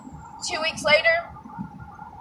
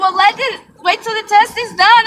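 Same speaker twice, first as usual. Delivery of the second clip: mild caution; 9 semitones higher, 5 dB louder than usual. Retold or imitated. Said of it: "She said".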